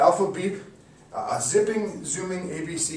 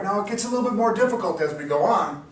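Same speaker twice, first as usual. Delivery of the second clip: ordinary talk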